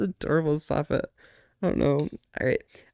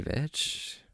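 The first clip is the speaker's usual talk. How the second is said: whispered; 9 dB quieter than usual. Acted. Whispered after cuddle me.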